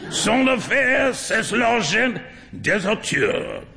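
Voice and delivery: slow, high character voice; lightly voiced old gentleman.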